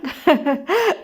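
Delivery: short teasing chuckle; a light acted laugh. A narrator's reading. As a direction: chuckle at her fussing. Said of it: "كنان بضحك".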